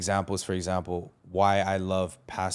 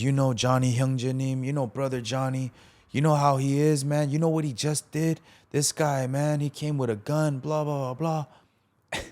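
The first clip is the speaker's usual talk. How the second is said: higher-pitched, insistent imitation of a complainer; agitated tattling; 5 semitones higher than usual. Pastor phoning Pastor John about Johnny's gun, agitated complaint. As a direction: imitate someone reporting a scandal, pitch raised.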